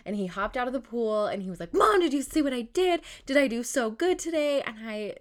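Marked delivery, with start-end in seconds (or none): 1.7-4.6 higher, louder imitation of a proud little boy; excited child calling to his mother; excited kid. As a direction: play a kid eagerly showing off to mom. Voicing her proud son after swimming.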